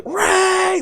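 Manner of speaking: very high drawn-out squeal; drunken whooping girls. Retold or imitated. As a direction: imitate a tipsy shrieked whoop. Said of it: "they come stumbling in with Fort Locos".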